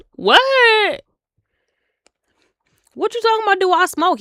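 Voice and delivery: mock outraged surprise; shocked disbelief. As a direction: squeal it out in disbelief. Her shocked reaction retold.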